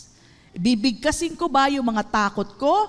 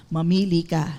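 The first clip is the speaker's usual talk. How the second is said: usual speaking voice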